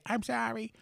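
meek put-on apology, very quiet; mock tearful apology. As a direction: imitate a crying little kid apologizing. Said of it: "She starts crying".

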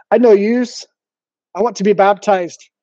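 put-on drunk Scottish woman, Scottish accent; slurred Scottish accent, drunk. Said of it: "I'm gonna do my best, uh, Scottish accent".

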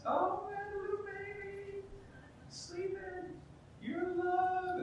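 put-on high, soft cooing over a baby; doting new parent. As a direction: coo over a sleeping newborn. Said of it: "these precious little ones".

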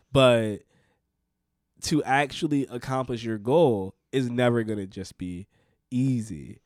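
ordinary delivery

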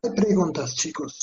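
ordinary talk

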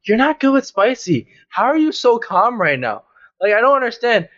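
mildly worked-up mock complaint; exasperated. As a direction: retell your baffled annoyance at a calm friend. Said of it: "it was making me so mad".

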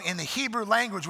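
usual speaking voice

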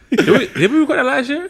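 high laughing disbelief. Real, not acted. Disbelief about recording date.

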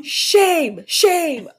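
loud, high cries of condemnation; mock public shaming. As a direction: call out shame like a jeering chanter. Mock public shaming of offended people.

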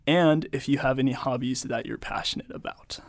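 ordinary delivery

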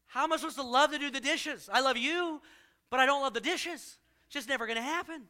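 husband protesting to wife, mock exasperated. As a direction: push back in mock frustration. Husband complaining in joke.

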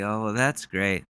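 ordinary delivery

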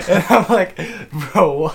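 high, loud words through laughter; amused, laughing disbelief; laughing while talking. Real, not acted. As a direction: crack up while retelling your reaction. Laughing after imitating the scream.

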